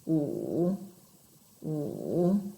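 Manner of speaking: "Wu", each dipping very low then rising; third tone, dipping. Shown as a demonstration. Teacher trying number five in third tone, low dip then up. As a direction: sink "Wu" to the bottom of the voice, then lift it. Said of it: "Go back to number five".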